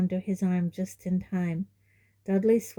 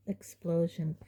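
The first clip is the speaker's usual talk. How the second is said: usual speaking voice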